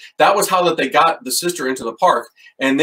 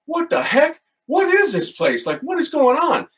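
much higher, panicky questions; freaked-out disbelief; 13 semitones higher than usual. Retold or imitated. Priest asking Father Ian what is happening, freaked out. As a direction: pitch it up like you're spooked. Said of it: "I'm like".

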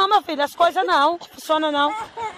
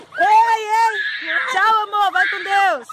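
raised, carrying farewell shout; 3 semitones higher, much slower than usual. Real, not acted. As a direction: call it out after him as he leaves. Saying goodbye to departing husband.

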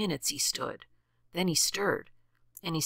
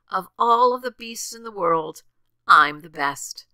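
louder, puffed-up bragging; loud boast; 3 semitones higher, 8 dB louder than usual. A narrator's reading. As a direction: boast it out loud, chest thrown out. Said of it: "he boasted out loud as he threw out his chest".

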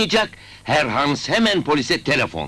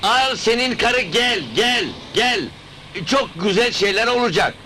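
high-pitched, insistent beckoning; calling them over; 10 semitones higher than usual. Acted. Summoning Şaban and his fiancée with good news, calling out. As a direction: call out high, urging them to come.

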